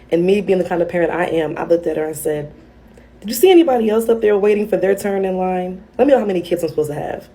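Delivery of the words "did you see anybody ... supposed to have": sassy retort. Retold or imitated. Mother snapping back at child.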